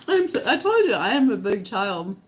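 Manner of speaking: ordinary delivery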